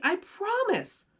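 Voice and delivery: put-on high kid's promise, earnest; pleading. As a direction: swear it like a hopeful boy. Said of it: "Max pleaded".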